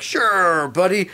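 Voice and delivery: put-on breezy celebrity kindness to a kid; friendly star voice. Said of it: "he was like".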